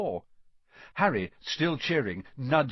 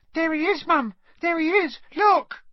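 put-on much higher, louder child's excited cry; excited little girl; 7 dB louder than usual. A narrator's reading. Ginny spots Harry and cries out to Mum, excited. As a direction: point and shout like a thrilled little girl.

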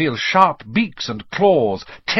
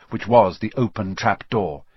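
usual speaking voice

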